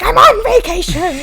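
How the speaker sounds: loud, high outburst of disbelief; exasperated shout. Retold or imitated. Leo shouting at the hospital lady, indignant yell.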